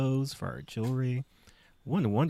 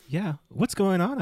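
anxious; 10 semitones higher, 6 dB louder than usual. Acted. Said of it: "I asked".

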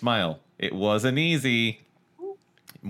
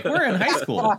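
mock high-schooler; 14 semitones higher, 4 dB louder than usual. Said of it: "to then just, like".

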